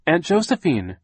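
high, loud searching call, falling at the end. A narrator's reading. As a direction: call out for a missing aunt. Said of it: "she called again".

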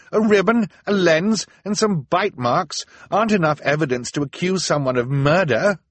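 high, loud, indignant argument; protesting cry. A narrator's reading. Violet argues against the mob's evidence, protesting cry. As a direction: hammer out each item in protest. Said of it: "Violet cried".

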